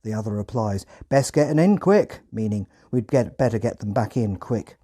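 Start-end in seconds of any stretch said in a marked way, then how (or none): 1.1-2.2 put-on Hampshire accent. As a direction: urge it like a Hampshire farmhand. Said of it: "in a thick Hampshire accent".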